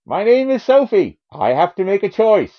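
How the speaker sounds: high-pitched, hammed-up actress impression; badly faked tragic heroine; Meryl Streep. Shown as a demonstration. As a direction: declaim it high with mock drama. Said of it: "And now Meryl Streep from the movies".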